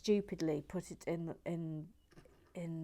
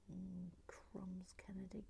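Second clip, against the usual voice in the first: whispered aside; 18 dB quieter than usual. Real, not acted. Muttered aside while building.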